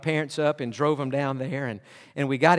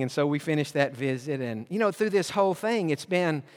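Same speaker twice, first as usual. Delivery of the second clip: ordinary talk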